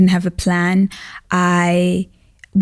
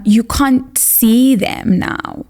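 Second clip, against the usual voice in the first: normal talking voice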